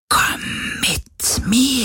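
long-drawn ghostly whisper; eerie beckoning, all breath; plaintive whisper. A narrator's reading. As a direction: lure them with a slow, haunting whisper. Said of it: "klagende Stimme".

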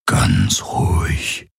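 very slow, hushed whisper. A narrator's reading. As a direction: draw the soothing words out in a whisper. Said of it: "flüsterte er".

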